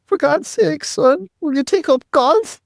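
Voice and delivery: high, slow exasperated plea; a pleading mom, drawn out and high; exasperated mother. Retold or imitated. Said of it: "She said".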